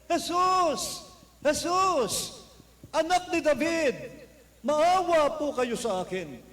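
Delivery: high, loud, slow begging call; a blind man shouting again and again to be heard; repeated crying out. Retold or imitated. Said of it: "Sumigaw siya paulit-ulit". (paulit-ulit na malakas na sigaw)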